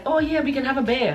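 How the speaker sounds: put-on Trinidadian accent. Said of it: "Like the time when I was like".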